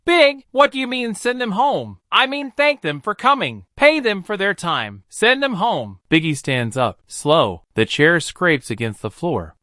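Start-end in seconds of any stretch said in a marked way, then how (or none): 0.0-6.0 lightly voiced Puffy, higher and urgent; a stunned manager, pushing back; panicked friend pleading. A narrator's reading.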